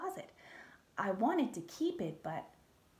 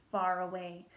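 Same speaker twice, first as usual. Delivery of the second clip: ordinary delivery, nothing marked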